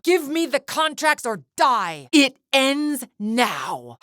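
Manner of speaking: high, drawn-out threat; slow, high-pitched fury; furious ultimatum. Acted. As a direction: demand surrender in a rage. Villain's final threat in fight.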